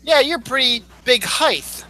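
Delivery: quoting someone, "height" ending in TH; mispronounced height. Retold or imitated. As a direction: say HEIGHT as "highth". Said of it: "I've never actually heard anybody actually say".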